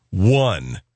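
flat curt low reply; blunt answer. Retold or imitated. Customer gives the worst rating, curt and low. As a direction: drop it like a verdict.

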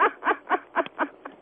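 long, high mocking laugh; derisive laugh. Real, not acted. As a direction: laugh at his boast. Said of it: "She's laughing at you".

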